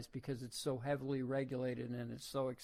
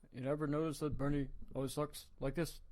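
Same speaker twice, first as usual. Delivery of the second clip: quick choppy Bernie-style delivery, lightly done; Bernie staccato; faster than usual. Retold or imitated. Host mocking Bernie's speech pattern to viewers, light staccato impression. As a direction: chop the words short and quick like Bernie.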